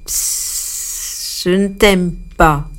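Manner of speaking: hissed Psst then a lightly voiced snake; snake hissing. A narrator's reading. Snake sneering at the hippo, hissing. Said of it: "il persifla".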